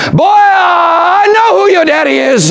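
high, slow, loud pronouncement; loud preacher voice. Retold or imitated. Preacher telling the boy before the church, loud and slow. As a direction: declare it for everyone to hear. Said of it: "He was talking so loud".

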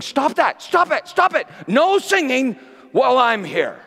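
frantic high shouted protest; ego yelling. Pretend. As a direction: yell like a tyrant ego putting a stop to it. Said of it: "my ego won't stop running around yelling".

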